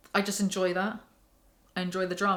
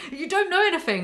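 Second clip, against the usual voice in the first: much higher, louder scolding; mad; 9 semitones higher, 3 dB louder than usual. Real, not acted. Reviewer scolding a book character, angry. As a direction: snap at the character in earnest. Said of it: "Why am I getting so mad?".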